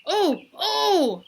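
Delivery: put-on a kid desperate to be called on; eager calling out. Mac calls out to the teacher who ignores him, excited.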